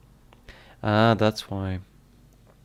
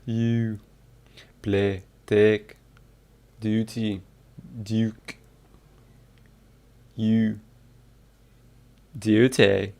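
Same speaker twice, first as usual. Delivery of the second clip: isolated Scottish vowel drill, word by word; practising Scottish accent; much slower than usual. Shown as a demonstration. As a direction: carefully sound out each word in Scottish. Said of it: "How to do a Scottish accent".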